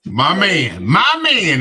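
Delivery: drawn-out impression with big swooping pitch; Denzel Washington. Co-host quoting a film star's line, impression. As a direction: stretch it out with a swaggering swoop. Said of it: "in my Denzel Washington voice".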